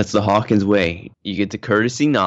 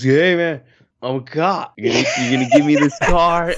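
higher, mock drunken pleading; drunk cop; 7 semitones higher, 4 dB louder than usual. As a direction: play a drunk cop demanding a car. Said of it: "He's all drunk".

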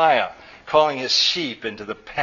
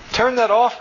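high, louder urgent order; crying out; 10 semitones higher, 5 dB louder than usual. A narrator's reading. Mother cutting off her son's video, crying out. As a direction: cry out to stop it at once. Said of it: "cried Nectar".

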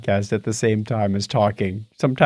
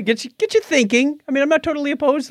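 excited; 12 semitones higher, faster than usual. Real, not acted. Excited about living longer.

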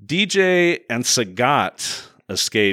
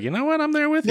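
high mock voice; faster than usual. Scientist quoted in movie recap.

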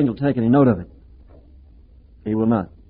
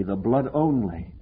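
"only" drawn out slow and low on a falling tune; stress on only; 5 semitones lower, 7 dB quieter, slower than usual. Shown as a demonstration. Preacher drives home the word "only", slow and low. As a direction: lean into the word "only" slowly. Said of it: "I emphasize this word only".

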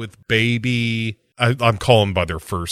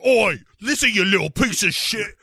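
high taunting cockney; mock him in cockney; 10 semitones higher than usual. Spice Girls mocking villain.

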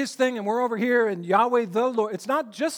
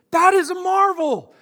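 higher and louder burst of wonder; awed exclamation; 8 semitones higher, 7 dB louder than usual. Real, not acted. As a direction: let the awe break out. Preacher marvels at God.

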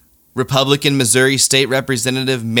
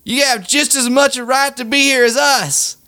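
much higher, shouted rally call; hammed-up megaphone plea; maga guy on a megaphone; 12 semitones higher than usual. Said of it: "the maga guy on the megaphone goes".

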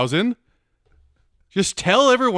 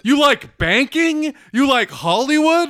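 slow mock boast; 6 semitones higher, 4 dB louder, slower than usual.

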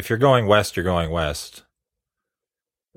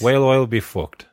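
Irish accent; 3 semitones higher than usual. Shown as a demonstration. Friend teaching an Irish accent joke, Irish accent. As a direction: say "whale oil" so it sounds like "well I'll" in Irish. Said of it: "Now say it all".

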